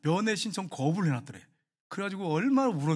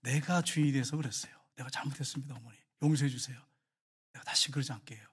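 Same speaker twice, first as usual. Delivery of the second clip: tearful apology; 5 semitones lower, 4 dB quieter than usual. Retold or imitated. Daughter-in-law apologizing to her mother-in-law, tearful and hushed. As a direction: quietly beg forgiveness through tears. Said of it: "울면서 그러더래요".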